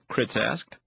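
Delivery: usual speaking voice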